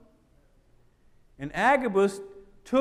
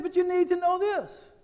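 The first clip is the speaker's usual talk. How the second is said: Paul's voice; 9 semitones higher than usual. Acted. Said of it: "He said".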